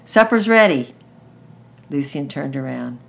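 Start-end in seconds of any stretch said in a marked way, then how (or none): 0.0-1.0 loud, high summons to supper; sharp, shrill shout. A narrator's reading. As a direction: call a child indoors, shrilly. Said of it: "she shouted".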